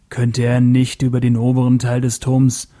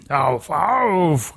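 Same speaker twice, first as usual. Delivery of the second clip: taunting call; 3 semitones higher than usual. Acted. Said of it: "rief er".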